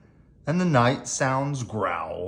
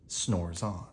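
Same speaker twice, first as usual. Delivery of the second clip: sleepy hush; 7 semitones lower, 9 dB quieter than usual. A narrator's reading. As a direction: lower and much softer, drifting off. Bear sleeping refrain.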